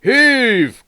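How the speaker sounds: loud, high call of command. A narrator's reading. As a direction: call the pull to the engines. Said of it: "called the Fat Controller".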